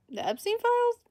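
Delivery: shrill high question; mock shock. Reading a chat question aloud, mock alarmed. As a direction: feign startled disbelief.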